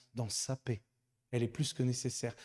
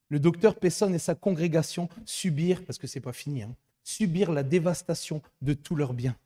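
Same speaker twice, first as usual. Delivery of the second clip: louder preaching narration; preaching at full force; 4 semitones higher, 6 dB louder than usual. Acted. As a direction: drive the story home to the crowd. Preaching the testimony story.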